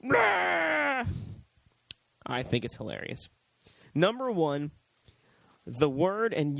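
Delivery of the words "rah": high, drawn-out mock roar; mock scream. Imitating Bill getting back up after being attacked, screaming. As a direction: imitate a screaming victim who won't stay down. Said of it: "screaming and going".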